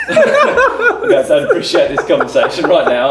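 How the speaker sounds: high, loud, slow protest through laughter; mock indignant. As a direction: act affronted while cracking up. Retells standing up to Gaz, laughing.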